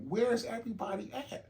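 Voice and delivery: high-pitched baffled question; puzzled alarm. Retold or imitated. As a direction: pitch leaps up, bewildered. Acts out finding job site empty.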